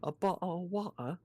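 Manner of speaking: warm-up phrase in mock-British; British accent. Shown as a demonstration. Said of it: "What phrase can I use to try and slap myself into it".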